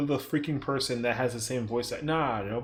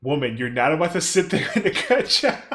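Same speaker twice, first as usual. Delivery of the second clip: laughing tease; 3 semitones higher, 6 dB louder than usual. Real, not acted. Teasing a friend, laughing.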